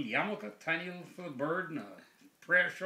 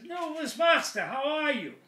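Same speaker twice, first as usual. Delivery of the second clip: high, loud parrot squawking a greeting; talking parrot; 12 semitones higher, 6 dB louder than usual. Acted. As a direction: call out in Yiddish as a parrot. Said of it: "the parrot says".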